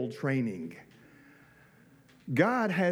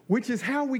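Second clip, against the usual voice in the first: ordinary talk, nothing marked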